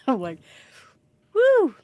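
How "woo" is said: high, loud woo; a delighted little cheer; excited whoop. Retold or imitated. Excited reaction to helpful assistant.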